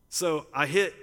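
ordinary talk

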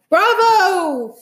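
high, loud cheer; long, high-pitched proud shout; yelling. A narrator's reading. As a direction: yell bravo as from the audience. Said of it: "I heard Dad yelling through his hands".

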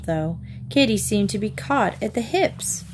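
usual speaking voice

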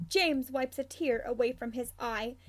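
plain reading voice

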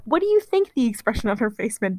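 normal talking voice

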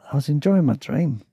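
ordinary delivery

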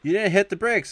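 high, loud imitated outburst; mock alarmed scolding. Passenger to driver after bumping a car, mock alarmed scolding. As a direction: yell it like a startled passenger.

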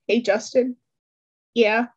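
ordinary delivery, nothing marked

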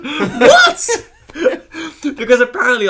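high, loud cackling laughter; laughing hard. Real, not acted. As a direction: crack up mid-sentence.

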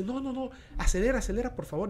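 high, urgent begging to speed up; panicked pleading. Retold or imitated. Wife begging her husband to accelerate, high panicked plea.

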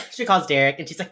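normal talking voice

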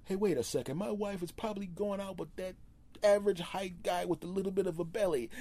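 high, put-on voice of a jealous husband; the husband suddenly suspects, voiced high; suspicious husband. Jealous husband eyeing the guy in a high mock voice. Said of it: "People aren't gonna go".

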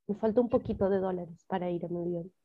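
ordinary talk

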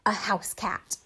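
hushed reveal, breathy and soft; dramatic whisper. Pretend. Narrating a TV reveal to viewers in a spooky hush. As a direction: whisper it like a big dramatic twist.

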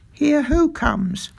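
"who" stressed in a high, slow question; puzzled echo question. A narrator's reading. Doctor puzzled by father's call, asking with "who" stressed. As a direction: query it with weight on "who".

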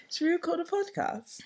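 high mock question. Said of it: "we're like".

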